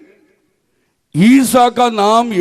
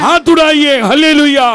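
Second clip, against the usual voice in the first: rousing praise call; 3 semitones higher, 4 dB louder than usual. Real, not acted. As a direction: urge the crowd on in raised voice. Preacher rouses congregation for response.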